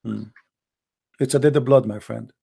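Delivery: ordinary talk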